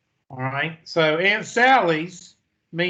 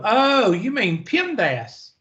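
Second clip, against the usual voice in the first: high, drawn-out pointed correction; mock know-it-all; 6 semitones higher, slower than usual. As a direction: imitate a pointedly puzzled student, slow and high. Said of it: "She would make it a point".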